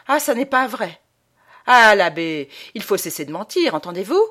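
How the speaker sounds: loud, menacing rebuke; violent conviction. A narrator's reading. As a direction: threaten him with fists clenched. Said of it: "une conviction si violente". (le fils, menaçant, crie sur l'abbé)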